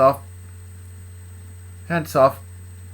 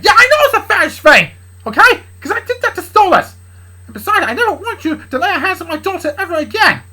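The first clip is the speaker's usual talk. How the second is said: high, loud protective tirade; angry retort; 13 semitones higher, 9 dB louder than usual. Acted. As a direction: yell him away from your daughter. Dad rages back at Charlie.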